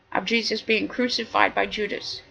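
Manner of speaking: ordinary talk, nothing marked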